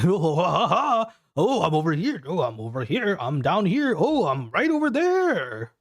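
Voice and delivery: high, cackling monster taunt from all sides; taunting plant monster. Acted. Plant monster taunting the blind hero, high laughing voice. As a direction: laugh and tease from the dark, high and mocking. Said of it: "the laughing of the plant monster pollinator going".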